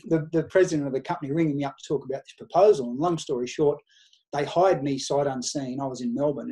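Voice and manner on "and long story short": ordinary delivery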